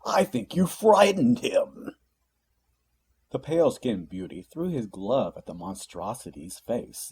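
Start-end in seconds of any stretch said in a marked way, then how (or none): 0.0-1.9 gruff demon voice, high, loud and slow, chuckling. A narrator's reading. Blue-skinned demon teasing his partner, in a gruff chuckling voice. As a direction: growl it out with a laugh, teasing. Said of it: "chuckled in a gruff voice".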